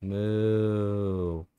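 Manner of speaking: a cow moo shown off to chat. Shown as a demonstration. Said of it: "Wait, listen to this".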